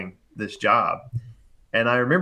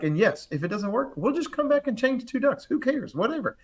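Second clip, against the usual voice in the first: put-on confidence; 10 semitones higher than usual. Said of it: "I had to be confident".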